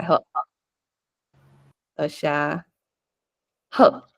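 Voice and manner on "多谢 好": usual speaking voice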